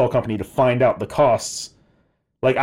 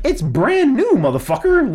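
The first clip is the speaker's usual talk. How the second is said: indignant retort; 11 semitones higher, 3 dB louder than usual. Retold or imitated. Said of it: "And I'm like".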